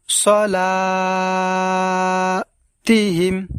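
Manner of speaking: সলাতিহিম with the লা held long and slow; slow recitation drawl on সলাতিহিম; long drawn vowel. Shown as a demonstration. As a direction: draw out the লা in সলাতিহিম. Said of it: "এই লা, এই জায়গায় আমরা টান দেব".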